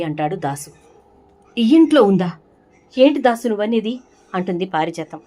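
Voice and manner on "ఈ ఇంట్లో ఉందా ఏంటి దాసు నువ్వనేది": shocked disbelief. Acted. Shocked at the revelation.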